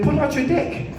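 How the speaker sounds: a hint of a bold woman at the van; woman's voice.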